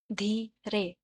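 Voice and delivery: "धीरे" broken into धी, रे; syllable by syllable. Shown as a demonstration. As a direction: pause between the two syllables of "धीरे". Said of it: "टू syllables". ("धीरे" रुककर, धी-रे)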